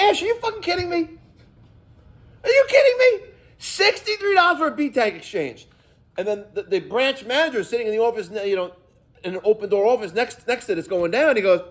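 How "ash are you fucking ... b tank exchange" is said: high, fast, rising to a near shout of disbelief; incredulous outburst. Retold or imitated. Plumber confronting the sales rep Ash about the price, high incredulous outburst.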